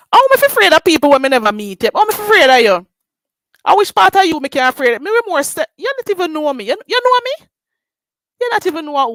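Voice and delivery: loud, high, fast Jamaican tirade; loud Jamaican patois rant; angry patois rant. Real, not acted. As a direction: tear into her in patois.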